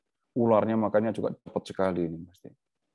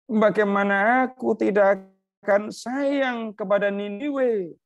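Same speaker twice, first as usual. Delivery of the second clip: God challenging Jonah, raised and insistent; God rebuking Jonah; 7 semitones higher, slower than usual. Acted. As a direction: press the point as God correcting a sulking prophet. Voices God about Nineveh.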